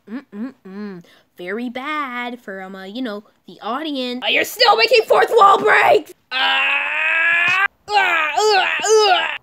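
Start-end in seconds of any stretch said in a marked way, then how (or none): 4.2-9.4 high screaming cries; panicked screaming. Acted. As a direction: scream in terror.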